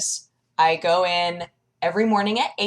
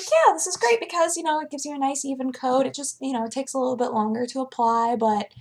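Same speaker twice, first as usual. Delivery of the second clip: makeup lady voice; 7 semitones higher than usual. Retold or imitated. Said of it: "She was like".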